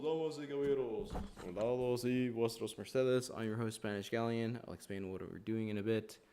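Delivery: loud, high announcer welcome; lucha libre announcer. Acted. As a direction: boom it out like a ring announcer. Streamer's intro as host.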